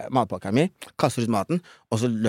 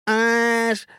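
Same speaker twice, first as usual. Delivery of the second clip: mocking girl's shriek; 9 semitones higher, much slower than usual. Classmate shrieking at him at lunch, high mocking disgust. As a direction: sneer it out like a nasty schoolkid. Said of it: "skriker hun".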